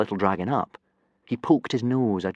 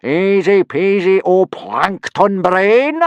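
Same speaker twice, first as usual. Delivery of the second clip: loud, slow, higher bellow; booming; 11 semitones higher, 11 dB louder, much slower than usual. Acted. Teacher booming down at a student. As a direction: boom it out, pleased with himself. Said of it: "boomed Gobber".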